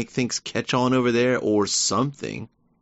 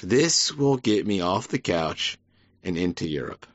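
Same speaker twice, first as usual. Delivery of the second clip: slowed mock fan. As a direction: stretch each word out lazily. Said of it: "They go".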